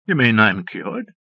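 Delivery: slow disbelieving question; incredulous. A narrator's reading. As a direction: ask it in disbelief. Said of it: "Oliver asked incredulously".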